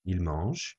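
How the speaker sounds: "mangent" said as "mange", the N and T silent; silent ending. Shown as a demonstration. Teacher showing the silent ending of "mangent". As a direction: end "mangent" on the soft G, no N or T. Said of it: "The N and the T are silent".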